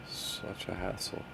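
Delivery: soft, slow whisper; whispered mutter. Real, not acted. Host mutters about the technical trouble, whispered. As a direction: grumble it almost silently.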